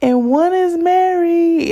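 high, strained mock wail; imitating herself sobbing over two bad options; retold crying. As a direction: whine it out like a crying fit. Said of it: "I cried 'cause I was like".

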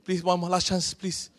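put-on child begging. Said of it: "that's what my children say".

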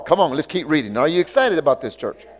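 ordinary delivery

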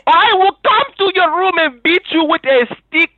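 put-on high, loud Nigerian-accented threat. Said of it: "like a Nigerian would do".

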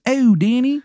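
over-the-top Maryland voice; Shithead Steve's Maryland accent. Acted. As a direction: announce yourself in a horrible Maryland accent. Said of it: "how bad this guy's fucking Maryland accent is".

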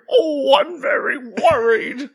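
high, slow, fretful character voice; worried cartoon voice. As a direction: squeak out the worry, slowly. Voicing cartoon character Alfred.

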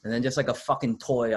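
ordinary delivery

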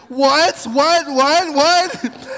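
high, loud climbing whats; flabbergasted king. Retold or imitated. King reeling at the accusation, high and loud.

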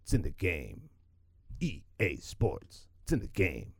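lightly voiced sports ad announcer; mock EA announcer. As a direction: boom it like an ad tagline. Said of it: "Now I just hear Sports".

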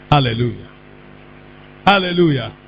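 ordinary delivery

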